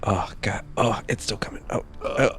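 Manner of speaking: hushed, strained, clutching through a cramp; pained groaning. Real, not acted. Cramping from tacos while playing, pained hush. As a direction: breathe through the stomach pain.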